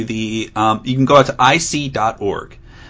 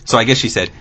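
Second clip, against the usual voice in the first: ordinary talk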